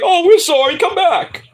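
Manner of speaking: high, loud begging voice; mock pleading. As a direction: beg her back in a whiny put-on voice. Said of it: "you're like".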